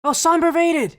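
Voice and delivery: excited surprise. Real, not acted. Streamer spots an incoming raid from Somber, excited surprise. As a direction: caught off guard by a raid, blurting it out. Raid notification pops up live.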